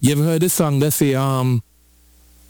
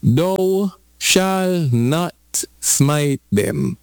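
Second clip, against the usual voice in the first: slow commanding decree; much slower than usual. Acted. Preacher voicing God's command.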